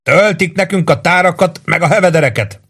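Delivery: sustained high-pitched call; narrated shout, pitch held up. A narrator's reading. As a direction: yell a report down from above. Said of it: "kiáltotta le Warden".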